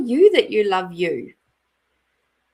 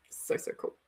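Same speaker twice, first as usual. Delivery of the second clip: whispered aside; 14 dB quieter than usual. Real, not acted. Soft aside.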